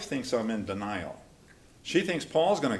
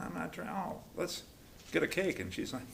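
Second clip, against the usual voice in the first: ordinary talk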